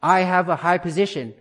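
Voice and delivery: a firm, ringing affirmation at normal pace; confident declaration. Shown as a demonstration. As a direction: speak it out with conviction, voice lifted. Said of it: "with conviction, with confidence, with clarity".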